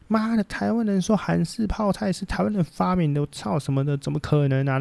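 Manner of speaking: lightly voiced, outraged internet commenter; mock outraged Korean netizen. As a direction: play an offended Korean netizen scoffing. Angry Korean netizens quoted.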